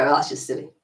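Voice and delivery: laughing it off as silly; chuckle, this is silly; light laughing dismissal; amused dismissal. Shown as a demonstration. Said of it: "you want your inner voice to go".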